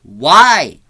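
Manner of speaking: loud, exasperated outburst; exasperated shout. Real, not acted. Reviewer protesting that Michael talks, exasperated shout. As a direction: cry out in disbelief at a bad choice.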